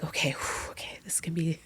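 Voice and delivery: hushed, breathy pep talk to self; nervous self-talk. Retold or imitated. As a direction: exhale and mutter under your breath, bracing. Steeling herself before a heavy performance.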